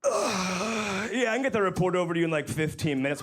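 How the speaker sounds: whiny coworker. Retold or imitated. Mocking a coworker on a call.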